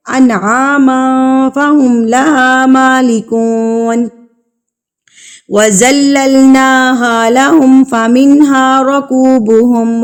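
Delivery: slow, drawn-out tajweed chant; slow melodic Quran recitation for students. Shown as a demonstration. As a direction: recite each syllable slowly and melodically.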